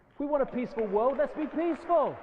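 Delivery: fervent climax; rousing appeal. Real, not acted. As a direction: push it out like a rallying cry. Rousing call to be peaceful.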